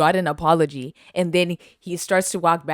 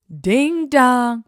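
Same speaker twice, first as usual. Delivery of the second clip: breathy mock ding dong; whispered doorbell; 6 semitones higher, 5 dB louder than usual.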